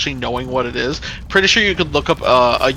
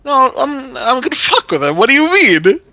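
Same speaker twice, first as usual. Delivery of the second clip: much higher, loud eager scheming; mischievous excitement; 8 semitones higher, 5 dB louder than usual. Retold or imitated. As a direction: gleefully announce the prank. Retold plan to mess with kid.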